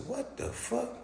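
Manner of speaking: high, hushed disbelief; squeaky mock what the fuck; shocked. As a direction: react stunned under your breath. Retold disbelief.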